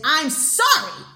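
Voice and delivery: loud, drawn-out disbelieving quote; incredulous mock apology. Incredulously quoting the tree's apology.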